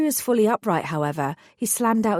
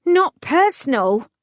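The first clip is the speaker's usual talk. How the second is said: higher, louder, slow outraged retort; spat in disbelief; 8 semitones higher, 6 dB louder than usual. Acted. Heroine throws the thug's excuse back at him, louder and higher. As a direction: spit the words back at him. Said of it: "I spat".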